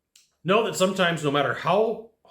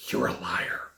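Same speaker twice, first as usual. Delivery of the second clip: threatening whisper; 11 semitones lower, 6 dB quieter, slower than usual. Retold or imitated. Voicing angry threatening customer.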